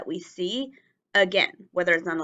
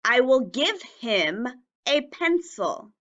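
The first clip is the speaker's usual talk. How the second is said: slow, careful model sentence; 4 semitones higher, slower than usual. Shown as a demonstration. Teacher modelling a sentence slowly for children. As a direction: say each word slowly for the class. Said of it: "Try putting the word him into a sentence".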